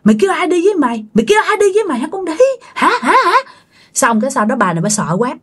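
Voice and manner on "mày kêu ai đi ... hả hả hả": high, very fast tirade; angry cursing. A narrator's reading. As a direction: scold relentlessly between slaps. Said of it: "Mà vừa tán vừa chửi". (vừa tát vừa chửi tới tấp, giọng cao)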